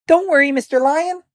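very high, tiny mouse voice; reassuring mouse. A narrator's reading. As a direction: squeak reassurance to the lion. Voices the small helpful mouse.

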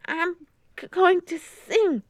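put-on high, slow, hesitant girl; nervous stammer. A narrator's reading. Nervous girl answering the teacher on stage, stammering. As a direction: stumble on the first sound, pitch up, drawn out.

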